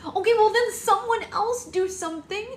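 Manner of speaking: a bride pleading with the hall, hysterical; hysterical crying. A narrator's reading. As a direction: cry out hysterically for help. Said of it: "Red hysterically cried".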